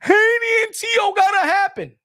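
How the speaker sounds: hammed-up fan voice demanding the fight; mock hype voice. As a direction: ham up a fan's excited demand. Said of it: "We wasn't talking about".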